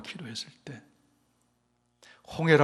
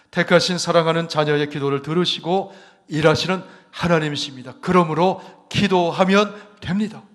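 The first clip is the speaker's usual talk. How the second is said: 1 preaching at full force; 4 semitones higher, 9 dB louder than usual. Acted. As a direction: build the sermon to its peak. Preaching builds to a climax. (점점 고조되는 힘찬 설교)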